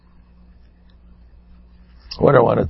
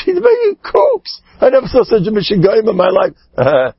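scream; 6 semitones higher than usual. Acted. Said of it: "And he screams".

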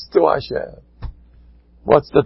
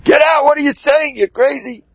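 high-pitched, outraged mock scream; pretend scream; 14 semitones higher than usual. Rav rehearsing with his shamus, screaming in pretend outrage. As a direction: explode in feigned outrage, voice way up. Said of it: "And I'm gonna scream".

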